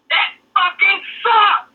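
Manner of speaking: high, drawn-out mimicked coach rant; mock outburst. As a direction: stretch it out high like an exasperated coach. Retold coach's outburst after the race.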